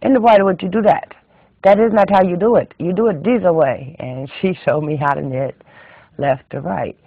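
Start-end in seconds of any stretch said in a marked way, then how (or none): 0.0-3.9 put-on high, loud scolding old-school knitter; bossy exclamation at a pupil's mistake; scolding Swedish teacher. Swedish teacher correcting the narrator.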